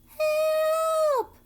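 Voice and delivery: very high, loud cry from far off; cry for help. A narrator's reading. A voice in the dark cries for help, high and loud. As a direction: scream it out of the darkness. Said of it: "came a cry".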